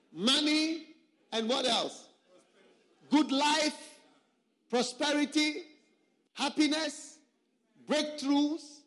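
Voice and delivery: high, loud mocking roll call of money and prosperity; mocking list.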